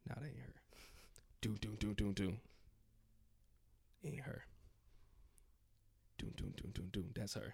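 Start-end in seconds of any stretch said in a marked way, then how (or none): 0.1-0.5 whisper. Retold or imitated. Listening for mom at door. 1.4-7.1 footsteps. Retold or imitated. Imitating footsteps in hallway.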